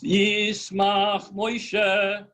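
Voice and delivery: slow, chanted Yismach Moshe. Shown as a demonstration. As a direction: draw Yismach Moshe out like prayer. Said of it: "you might say".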